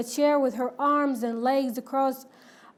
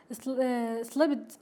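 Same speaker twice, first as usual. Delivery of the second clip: ordinary delivery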